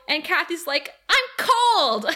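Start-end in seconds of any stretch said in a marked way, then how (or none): 1.1-2.0 high, loud mock whine; whiny complaint. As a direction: imitate a roommate whining in protest. Acted roommate quarrel over window.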